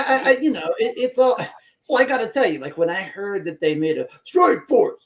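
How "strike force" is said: mock-impressed emphasis, sardonically; sardonic. Real, not acted. Host scoffing at news of a new task force, sardonic. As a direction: say it with a touch of scorn. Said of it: "I have to say that sardonically".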